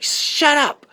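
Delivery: high-pitched mock angry woman; Sarah. Woman silencing David after his complaint, high-pitched mock anger. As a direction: snap it in a high put-on woman's voice. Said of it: "She's like".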